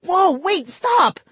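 high, loud panicked yelp; a scared boy shrieking for it to stop; frightened cry. Acted. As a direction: cry out as tools fly at you. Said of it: "Vinny cried".

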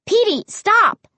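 high, slow shout. A narrator's reading. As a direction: call out sharply to the dog. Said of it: "I cried".

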